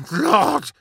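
high, loud dying cry. A narrator's reading. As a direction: cry out with blood in the throat. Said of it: "he cried".

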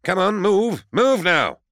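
loud, high, urgent bellow. A narrator's reading. Fischig driving civilians out of the square, bellowing. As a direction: bellow at people to get moving. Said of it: "Fischig bellowed".

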